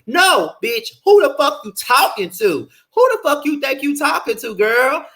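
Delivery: high, sassy confrontational voice pretending to be Shay; furious clapback. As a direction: fire back at her as if you were Shay. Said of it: "That's what I would've said if I was Shay".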